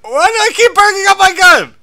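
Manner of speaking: real high, very loud screeched complaint; high-pitched frantic shouting; panicked yelling. Real, not acted. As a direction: yell it shrieking high in frustrated panic. Panicking under attack.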